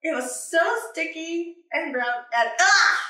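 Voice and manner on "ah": startled ah. Acted. Squelching into sticky mud.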